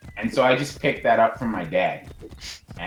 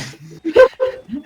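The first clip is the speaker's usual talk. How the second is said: high squealing laugh; squealing laugh, much higher; 11 dB louder than usual. Real, not acted. Laughing at own story.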